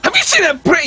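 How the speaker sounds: very high, loud mock scream; retold screaming in a high put-on voice. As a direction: screech the question like a deranged prankster. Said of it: "He just starts screaming".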